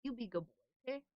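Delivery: soft coaxing pet talk; cooing at the cat. Real, not acted. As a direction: coax a grumpy cat gently.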